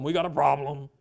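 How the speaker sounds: lightly put-on low, heavy voice; a hint of a self-important fixer; mock controlling voice. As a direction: drop low, mimicking someone taking control. Mimics a controlling attitude.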